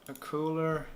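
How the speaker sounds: normal talking voice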